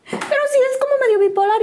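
high, rapid motherly protest; imitated alarmed mother. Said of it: "Y mi mamá".

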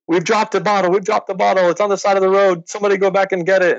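high panicked voice, rushing at the end; frantic rider yelling into a walkie-talkie about a lost bottle; frantic team radio call. Retold or imitated. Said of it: "on a walkie-talkie saying".

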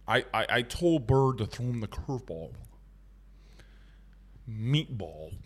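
a lightly voiced low old coach; Skip Bertman impression. Host mimicking Skip Bertman for chat, low and soft. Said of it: "Skip Bertman impression".

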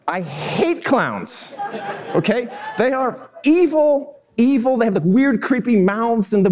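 drawn-out, high comic indignation; mock outrage. Duped storyteller ranting about clowns, hammed-up outrage. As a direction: stretch out the grievance, climbing high. Comic rant after the punchline.

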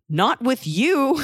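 drawn-out flat no; refuse, slowly and pointedly; slow indignant refusal. Retold or imitated. Refusing the receptionist.

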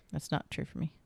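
whispered quietly. Real, not acted. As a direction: breathe it, no voice. Whispered answer.

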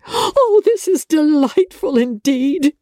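high, gushing, fluttering mother; a silly mother beside herself with delight; giddy mother rattling off wedding plans; Mrs Bennet, overjoyed. A narrator's reading. Narrator voices Mrs Bennet.

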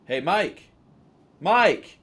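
high, loud shout across a bar; drunken buddy hailing someone; calling out. Retold or imitated. [name] acted calling across bar.

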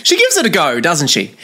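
high-pitched mock exclamation over the film; retold exclamation. Husband clutching a gin, watching Nicole, high exclamation. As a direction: exclaim it high, falling back at the end.

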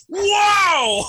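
very high, loud mock whoa; a wild animal cry of a whoa; imitated commentator whoa. Retelling the bobsled commentator's cry, very high and loud. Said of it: "He had a".

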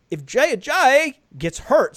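a practiced British line; British accent. Shown as a demonstration. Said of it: "see, that I got".

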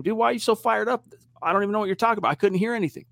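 acted-out bewildered quarterback, voice lifted; baffled protest. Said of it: "Drew was basically trying to communicate to him".

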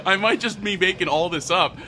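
high laugh then giggly words; laughing at himself. Real, not acted.

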